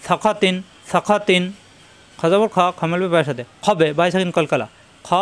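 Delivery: ordinary talk, nothing marked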